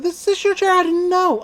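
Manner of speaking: high, sweet mock-innocent boy voice; Gideon voice. Gideon teasing Stan over the chair, in a high mock-innocent voice.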